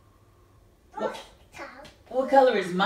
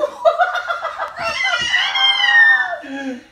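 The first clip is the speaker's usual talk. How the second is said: very high, louder shrieking laugh; excited shrieking laugh; 7 dB louder than usual. Real, not acted. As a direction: squeal with laughter. Excited about purple car.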